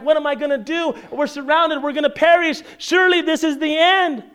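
high-pitched mock panic; panicked. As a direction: act out a servant sure the end has come. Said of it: "That servant is all panicked".

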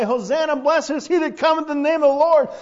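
loud, high shout of praise; jubilant crying out. Retold or imitated. Preacher voicing children hailing Jesus, loud high proclamation. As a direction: proclaim it like a cheering procession. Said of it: "all the children are walking around saying".

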